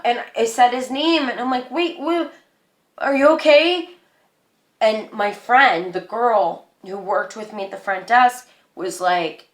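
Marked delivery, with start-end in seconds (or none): 1.7-3.9 high-pitched, anxious questioning; acted-out startled concern; alarmed concern. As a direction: check on him, voice pitched up. Calling to upset manager, retold.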